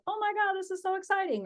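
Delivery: high, gushing mock excitement; acted excitement. Coach acting out a client thrilled by a lead, excited. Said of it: "you may be excited and be like".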